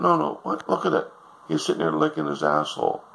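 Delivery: high, shrill-pitched complaining wife; nagging wife. Acted. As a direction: whine it like a disgusted wife. Wife demands dog go.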